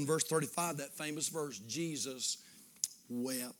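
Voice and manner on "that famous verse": ordinary delivery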